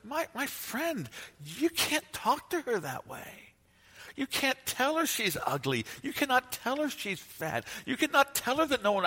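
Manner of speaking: a pastor stunned, gently correcting a husband; astonished rebuke. Retold or imitated. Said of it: "you say to the husband".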